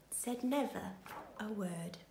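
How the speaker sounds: whisper. A narrator's reading. Ladybird silent, hushed ending.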